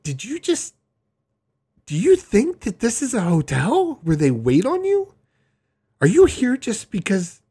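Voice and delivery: can't believe what she's hearing; incredulous. Retold or imitated. To her mom in the hospital, high disbelieving questions. Incredulous at mom's hotel mistake.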